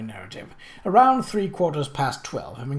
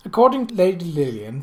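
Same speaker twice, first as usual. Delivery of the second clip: usual speaking voice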